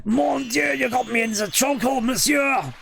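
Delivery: put-on high, shouted French accent; funny French accent. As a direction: squeal it in a hammy French accent. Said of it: "the funny French accent".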